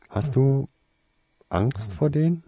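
high, hesitant question with a pause; cautious. A narrator's reading. Girl asking whether he fears them, cautious. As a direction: feel your way into a delicate question. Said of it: "erkundigte sie sich vorsichtig".

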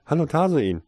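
high, loud hello; lifted girlish shout of hello; bright greeting. A narrator's reading. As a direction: call out a greeting to your boyfriend. Girlfriend calls out a greeting.

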